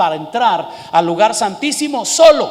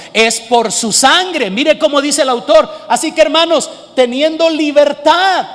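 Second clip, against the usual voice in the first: preacher lifting the congregation; preaching at full force; 5 semitones higher, 5 dB louder than usual. Acted. Preacher to congregation, loud fervent proclamation.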